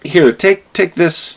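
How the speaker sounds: mock high helpful boss; high offering voice. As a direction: offer the manager up brightly. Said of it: "they're like".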